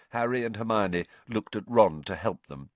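ordinary delivery

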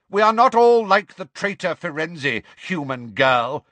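loud, high start, slowing at the end; angry shout. Acted. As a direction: shout down the human girl with scorn. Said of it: "shouted the grey centaur".

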